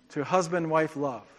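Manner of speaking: ordinary talk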